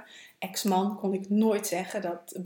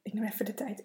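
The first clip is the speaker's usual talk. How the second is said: tearful whisper, real emotion; choked-up whisper; 5 dB quieter, faster than usual. Real, not acted. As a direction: whisper through rising tears. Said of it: "dat het me raakt".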